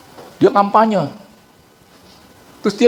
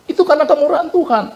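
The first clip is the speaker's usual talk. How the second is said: emphatic insistence; 13 semitones higher, faster than usual. Retold or imitated. Preacher retorts that all is God's grace, raised and emphatic. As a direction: press the point hard and fast. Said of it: "saya bilang".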